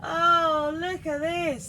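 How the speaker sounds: excited. Real, not acted. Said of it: "this is exciting".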